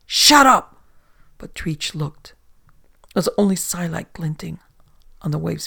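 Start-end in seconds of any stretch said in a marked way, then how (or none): 0.1-0.8 loud, high snapping shout; captain shouting down panic; furious shout. Acted. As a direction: shut him up at full voice. Treach silencing frightened sailor.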